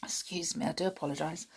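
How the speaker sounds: usual speaking voice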